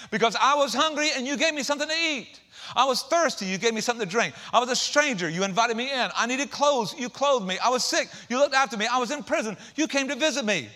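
preached loud and high as the king. Acted. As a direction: proclaim it like a joyful king. The king speaking in the parable.

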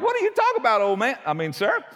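a playful what-are-you-talking-about; startled parents. Retold or imitated. Joseph and Mary questioning the old man, mock surprise. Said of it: "Joseph and Mary are like".

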